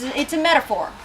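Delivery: plain reading voice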